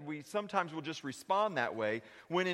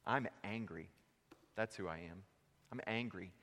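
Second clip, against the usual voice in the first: quiet confession; 7 semitones lower, 11 dB quieter than usual. Acted. Preacher voicing a hurting churchgoer, low and soft. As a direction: lower and softer, heavy with hurt.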